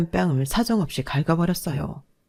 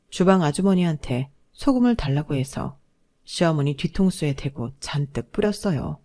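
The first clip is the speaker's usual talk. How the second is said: ordinary delivery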